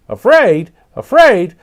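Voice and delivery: high, loud parrot clucks; clucking parrot. A narrator's reading. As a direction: cluck it back in disbelief. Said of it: "clucked Papagayo".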